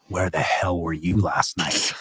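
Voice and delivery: low, hushed, ominous trailer voice; movie trailer voice. Retold or imitated. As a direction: growl it like a dark movie trailer. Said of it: "that's my movie trailer voice".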